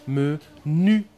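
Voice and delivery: "Me", then "nu", separated and held; syllable by syllable. Shown as a demonstration. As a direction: let each of "Me" and "nu" ring separately. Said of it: "qu'est-ce que j'entends à la fin".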